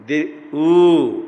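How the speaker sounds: "ऊ" बहुत धीरे, देर तक खींचकर; long drawn vowel. Shown as a demonstration. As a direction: hold the ऊ slow and long. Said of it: "इसकी ह्रस्व दीर्घ प्लुत संज्ञा". (लंबा खिंचा ऊ)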